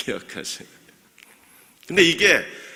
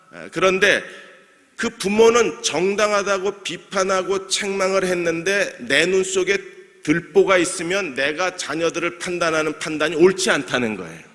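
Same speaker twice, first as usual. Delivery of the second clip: usual speaking voice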